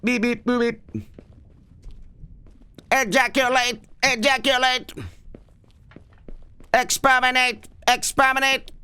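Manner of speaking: high monotone robot voice. Pretend. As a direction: bleat it like a machine alarm, all on one high note. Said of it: "I'll talk like a robot now".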